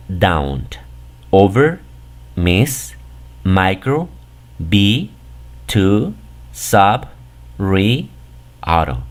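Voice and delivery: down, over, miss, micro, be, to, sub, re, auto, each isolated, mostly on a rising list tune; prefix pronunciation. Shown as a demonstration. Teacher lists prefixes for learners to pronounce, careful separate demo of down, over, miss, micro, be, to, sub, re, auto. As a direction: read down, over, miss, micro one by one with pauses between. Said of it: "Este primer prefijo se pronuncia".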